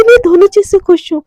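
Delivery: excited. Retold or imitated. Radhika gleeful on the phone.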